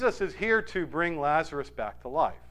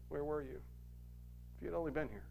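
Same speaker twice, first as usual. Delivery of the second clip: grieving lament; 15 dB quieter, much faster than usual. Retold or imitated. Voicing grieving Mary.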